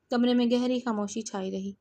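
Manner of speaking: plain reading voice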